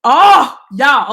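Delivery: ordinary talk, nothing marked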